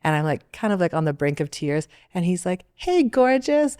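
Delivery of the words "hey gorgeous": a hint of a cheery stranger, higher and slow; friendly stranger. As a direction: greet her like a kind passerby, drawn out. Stranger greets her in alley.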